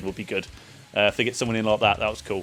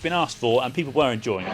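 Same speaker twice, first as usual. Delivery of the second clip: ordinary delivery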